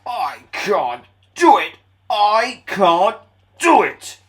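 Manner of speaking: loud, slow, straining puff; puffing Gordon. Acted. Gordon straining up the hill. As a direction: strain on every word. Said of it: "puffed Gordon".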